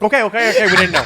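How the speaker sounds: hammed-up Indian accent giving in. Friend retells the store caving, mock Indian accent. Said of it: "talked in an Indian accent".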